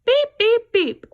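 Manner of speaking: very high, loud truck beeping; truck beeps. Shown as a demonstration. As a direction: honk like the little blue truck. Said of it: "who says beep, beep, beep?".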